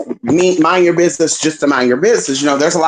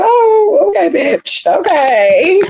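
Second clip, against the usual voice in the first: sassy mock acceptance; 14 semitones higher, much slower than usual. Telling the viewers about the Texas talk, high sassy acceptance. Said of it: "I was like".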